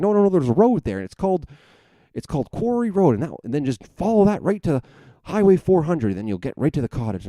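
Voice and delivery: high, insistent dad impersonation; dad correcting directions. Retold or imitated. Dad correcting son about Quarry Road, high mimicked voice. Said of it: "He's like".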